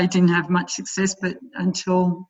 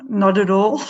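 laughing; 2 semitones higher, 4 dB louder than usual. Real, not acted. Laughing denial.

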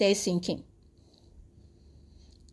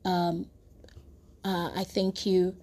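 genteel, shy prayer; 2 semitones lower, 5 dB quieter than usual. Shown as a demonstration. Preacher mimicking overly polite prayer to God, shy and restrained. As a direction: pray too politely, soft and hesitant. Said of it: "be a gentleman or a lady".

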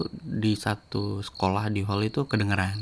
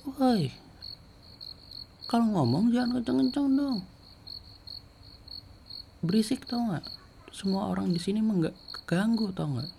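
much higher, scolding voice; retold angry yell; 13 semitones higher than usual. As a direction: imitate her high-pitched angry telling-off. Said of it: "dia teriak".

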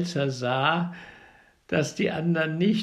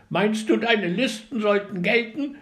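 higher, louder scolding; angry birds; 6 semitones higher, 6 dB louder than usual. A narrator's reading. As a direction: challenge him angrily. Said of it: "noch zorniger".

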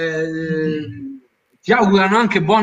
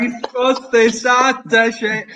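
big bright host announcement; showy game show host; 3 semitones higher than usual. Acted. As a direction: hype it like a quiz host. Host announcing correct answer.

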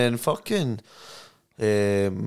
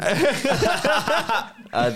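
laughing; 13 semitones higher than usual. Real, not acted. Boasting, laughing.

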